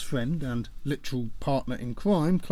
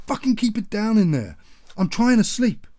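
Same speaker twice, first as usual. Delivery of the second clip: loud, high shout through the wall; father yelling at his family to keep it down; angry yell; 8 semitones higher, 7 dB louder than usual. Acted. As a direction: roar at them to shut up.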